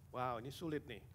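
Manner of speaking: ordinary talk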